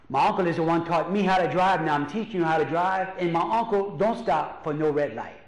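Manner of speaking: normal talking voice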